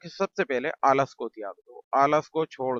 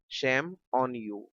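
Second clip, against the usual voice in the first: slow repeat; much slower than usual. Shown as a demonstration. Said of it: "teacher says then repeats slowly".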